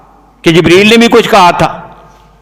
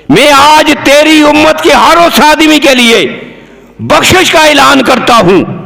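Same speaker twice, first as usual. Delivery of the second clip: high, ringing declamation; preaching proclamation; 8 semitones higher than usual. Acted. Preacher voicing God's pardon to the Prophet's community, proclaiming. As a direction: proclaim it in a raised preacher's pitch.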